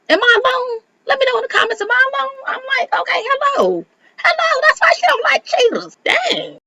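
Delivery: very high, squeaky put-on voice; squeaky comic aside. Host asking viewers if she's alone, squeaky and high. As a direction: squeal it in a tiny falsetto.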